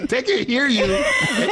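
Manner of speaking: words bubbling through laughter; laughing disbelief. Real, not acted. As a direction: warn him while cracking up. Both laughing at Barry's blunder.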